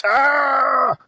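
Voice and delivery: sudden high laughing yelp; laughing shout. Real, not acted. Cracking himself up about [name], high yelp. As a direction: blurt out a shrieking laugh. Laughing outburst at own joke.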